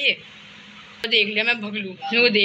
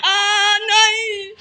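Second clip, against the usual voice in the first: high, loud sung-out no; anguished wail; 9 semitones higher, 4 dB louder, much slower than usual. Real, not acted. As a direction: wail in despair. Caught, wailing no.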